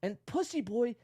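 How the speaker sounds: high mocking read; mocking John. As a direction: read his message like a whiny rival. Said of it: "then John comes back and says".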